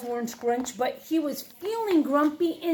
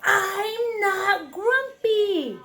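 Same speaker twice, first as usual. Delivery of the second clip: very slow, wailing shout, pitched higher; 7 semitones higher, 3 dB louder, much slower than usual. Acted. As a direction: drag it out in a crying yell. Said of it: "shouted Jim".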